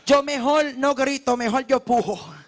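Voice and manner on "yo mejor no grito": ordinary talk, nothing marked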